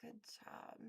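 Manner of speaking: hushed whispered praise; soft whisper. Real, not acted. Streamer whispering praise to a viewer. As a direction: whisper it gently.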